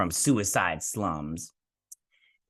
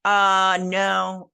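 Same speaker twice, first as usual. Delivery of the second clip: incredulous no; 7 semitones higher, 5 dB louder than usual. Retold or imitated. Her own reaction to dull dances.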